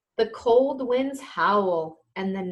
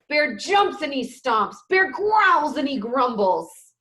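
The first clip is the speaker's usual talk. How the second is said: louder, energetic storytime narration; lively loud read-aloud; 5 semitones higher, 6 dB louder than usual. A narrator's reading. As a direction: read the angry bear loudly. Reads a picture book aloud.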